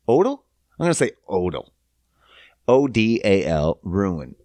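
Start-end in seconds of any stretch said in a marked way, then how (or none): none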